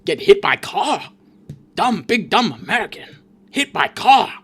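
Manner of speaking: hammed-up Korean-accented tourist gawking; mock Korean tourist accent. Korean tourists gawking at an injured American, mock Korean accent. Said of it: "it's, like, thirty Korean tourists".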